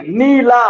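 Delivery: नीला with a long, pushed ee; stressed long vowel. Shown as a demonstration. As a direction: lean hard on the first vowel of नीला. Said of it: "जहां जोर लगे आपको".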